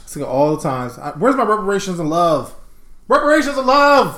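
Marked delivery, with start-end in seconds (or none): 3.1-4.2 high, raised mock-outraged cry; comic high-pitched yell; yelled demand. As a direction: call it out high like a protest. Repeats comic demand, now yelled.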